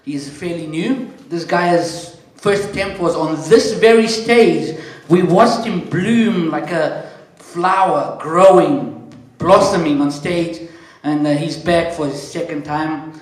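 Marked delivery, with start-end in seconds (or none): 1.3-10.7 slow, louder build like a stage announcer; hype MC intro. Acted. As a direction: sell the comic to the room, drawn out. Hyping up the next comedian.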